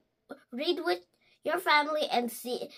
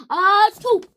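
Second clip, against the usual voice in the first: big loud achoo; loud sneeze; 5 semitones higher, 13 dB louder than usual. Acted. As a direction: sneeze with full force.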